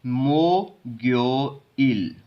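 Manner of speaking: मो ग्यो इल slowly, syllable by syllable; linked reading. Shown as a demonstration. Teacher models the transferred consonant, slow linked मो ग्यो इल. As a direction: open मो, drawn-out ग्यो with g, then इल. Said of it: "इसको पढ़ेंगे".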